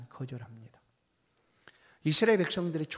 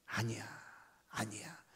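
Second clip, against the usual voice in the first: devil coaxing; 4 semitones lower, 9 dB quieter than usual. Acted. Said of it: "사단 마귀는 와서 부추깁니다".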